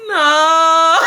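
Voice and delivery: high, loud, drawn-out no; hammed-up teenage wail; mock crying. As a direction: howl the denial, then crack up. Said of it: "Bitch, I am crying".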